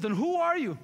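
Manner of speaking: a bold challenge from Jesus; Jesus confronting the demon; demanding Jesus. Retold or imitated.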